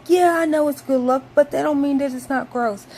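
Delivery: high, loud annoyed comeback; loud, high indignant reply; exasperated retort. Retold or imitated. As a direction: snap back at mom, grossed out. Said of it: "And I'm like".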